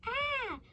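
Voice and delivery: put-on short high shriek of a woken child; child's scream. Orphans woken by worms down their shirts, high scream. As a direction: cry out high and sharp as you wake. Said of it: "up screaming".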